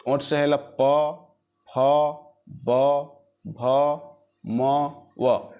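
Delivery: slow, deliberate प फ ब भ म व, each closed at the lips; teacher sounding out lip consonants one by one; lip sounds demo. Shown as a demonstration. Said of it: "एक बार उच्चारण करके देखो".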